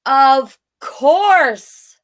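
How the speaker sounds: loud, high, drawn-out exclamation; dog's sudden realization. A narrator's reading. Voicing Woof's sudden realisation.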